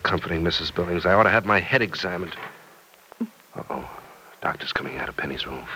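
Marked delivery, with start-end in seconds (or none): 3.5-5.6 quick low whisper of warning; whispered alert as the doctor appears. Acted. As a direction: lean in and whisper the news. Warning in hospital corridor.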